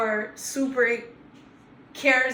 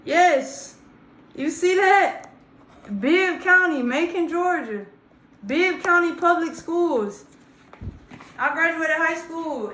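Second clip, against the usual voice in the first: emphatic; 4 semitones higher, 4 dB louder than usual. Real, not acted. Showing proof of his school.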